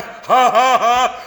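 high, loud hammed-up laugh; acted helpless "ha ha" laughing fit; acted laughter. Member joins the room laughing at him, acted laughter. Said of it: "I was laughing too".

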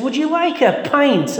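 high, slow lilting offer; imitating an Irish barmaid's offer, drawn out. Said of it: "and she said".

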